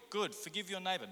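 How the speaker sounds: ordinary delivery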